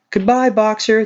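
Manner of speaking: chorused goodbye. Acted. The animals crowd the van bidding Boxer farewell, cheerful call. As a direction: wave him off brightly. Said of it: "they chorused".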